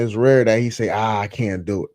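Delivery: ordinary talk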